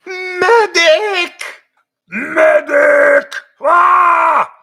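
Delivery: high, loud frantic battlefield cry; Medic voice. Pretend. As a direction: yell for the medic mid-battle. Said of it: "Sometimes I go into Medic's voice".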